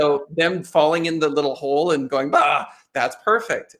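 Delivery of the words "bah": mock kid grunt. Child falling in game hole.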